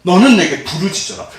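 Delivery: a voiced cry to God, pitched a touch up. Shown as a demonstration. Pastor showing the congregation how to cry out, pleading. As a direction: call out the verse like a plea. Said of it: "이렇게 울부짖는 거예요".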